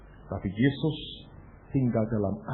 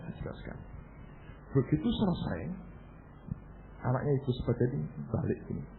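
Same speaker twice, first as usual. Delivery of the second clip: ordinary talk, nothing marked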